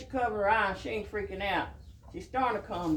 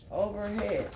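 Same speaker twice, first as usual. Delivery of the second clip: ordinary talk, nothing marked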